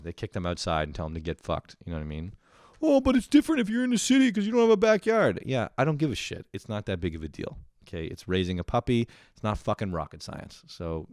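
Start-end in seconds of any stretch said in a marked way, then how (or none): 2.8-5.4 much higher, fast, louder whiny voice; mock whiny objector. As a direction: mimic a city dweller's excuse. Mocking imagined city objector.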